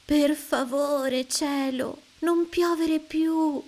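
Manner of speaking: high, slow begging voice; pleading children. A narrator's reading. Children begging the sky to stop raining, high and slow. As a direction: beg the sky sweetly. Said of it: "dicono tutti".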